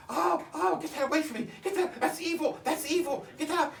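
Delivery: high panicked screaming as the aunt; aunt screaming in terror. Retold or imitated. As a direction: shriek it like the terrified aunt pushing something evil away. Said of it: "My aunt starts screaming".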